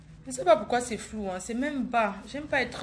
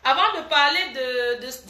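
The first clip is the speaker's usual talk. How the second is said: ordinary talk, nothing marked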